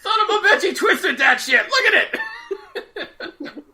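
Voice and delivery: loud, high-pitched jeer; mock outraged heckler. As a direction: holler in hammed-up amazement.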